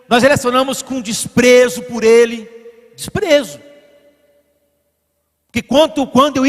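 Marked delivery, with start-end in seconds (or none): none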